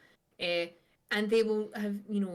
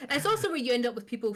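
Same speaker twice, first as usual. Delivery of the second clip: louder, higher, laughing through it; 7 semitones higher, 11 dB louder, faster than usual. Real, not acted. Joking about Scottish Twitter imitators: laughing loudly. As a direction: keep laughing as you talk.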